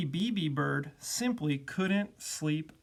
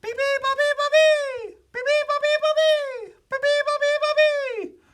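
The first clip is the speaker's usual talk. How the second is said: piercing high, loud repeated chirps; very high bird chant; bird call; 10 dB louder than usual. A narrator's reading. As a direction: chirp like a noisy little bird. Baby bird sings its call.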